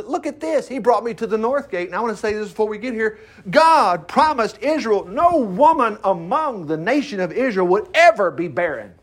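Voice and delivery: ordinary talk, nothing marked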